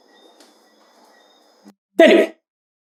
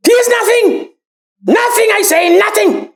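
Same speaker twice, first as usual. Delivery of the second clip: shouting; 7 semitones higher than usual. Acted. Boasting that Gaston is nothing.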